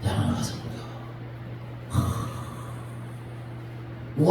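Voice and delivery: low, soft and gentle; Jesus gently reassuring Peter, low and soft; tender comfort. Acted. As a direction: speak like consoling a broken friend.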